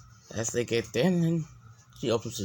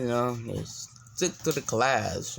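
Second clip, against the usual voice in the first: ordinary delivery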